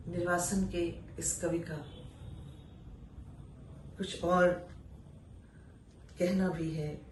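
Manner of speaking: ordinary talk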